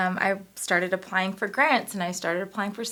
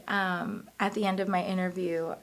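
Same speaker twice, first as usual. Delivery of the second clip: normal talking voice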